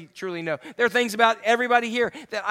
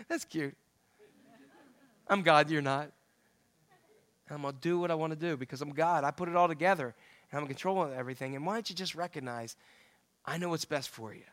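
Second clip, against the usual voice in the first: softer, lower, unbothered God voice; calm amused God; 6 semitones lower, 9 dB quieter than usual. Retold or imitated. God answering frustrated people, calm and amused. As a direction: speak as a calm father God, unfazed. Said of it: "And God goes".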